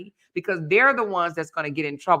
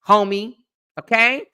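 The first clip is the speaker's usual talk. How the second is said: normal talking voice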